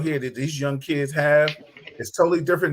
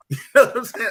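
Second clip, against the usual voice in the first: laughing; 7 semitones higher, 5 dB louder, faster than usual. Real, not acted. Laughing after story.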